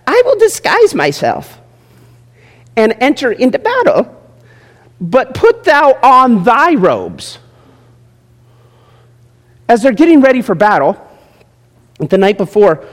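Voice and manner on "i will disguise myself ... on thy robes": lightly voiced scheming king. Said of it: "king's voice read from scripture".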